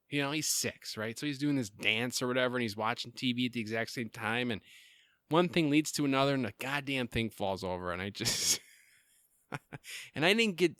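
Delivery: ordinary talk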